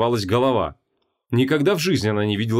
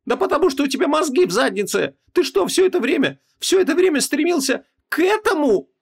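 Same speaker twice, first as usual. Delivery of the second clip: very high, outraged woman's voice; indignant tirade. A narrator's reading. Wife berating husband for his ambitions, outraged. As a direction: berate him, rising to a shrieked question.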